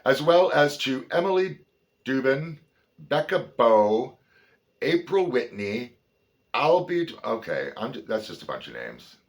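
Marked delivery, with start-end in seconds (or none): 0.0-7.1 loud, high, presentational read; big projected reading voice; acknowledgements read aloud. Acted. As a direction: announce your thanks to an audience. Reads list of names.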